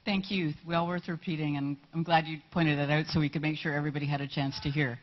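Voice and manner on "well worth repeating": ordinary talk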